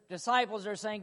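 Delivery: normal talking voice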